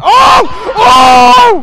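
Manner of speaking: very high scared yell; startled scream. Real, not acted. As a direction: shriek in sudden fright. Jump scare.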